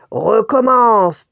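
slow, thundering bear order; voix tonnante. A narrator's reading. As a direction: thunder it, loud and drawn out. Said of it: "tonna l'ours". (ordre tonnant de l'ourse)

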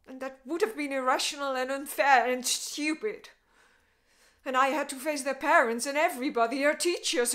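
loud, mounting upset; worked-up anguish. Acted. Woman telling Jimmy whom she had to face alone, loud anguish.

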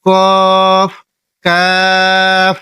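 কফ then কাফ, each held long; drawn-out Arabic letters. Shown as a demonstration. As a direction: stretch each letter slowly. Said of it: "এটাও চার second". (কফ আর কাফ টেনে)

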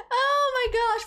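normal talking voice

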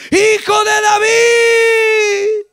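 very high, long-held desperate cry; full-lunged shout. Acted. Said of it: "gritaba a todo pulmón y aún más fuerte".

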